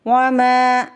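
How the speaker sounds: वमा with the मा drawn long; long meem. Shown as a demonstration. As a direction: stretch the मा of वमा. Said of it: "मीम को खींच के अदा करेंगे". (वमा में मीम को खींच कर)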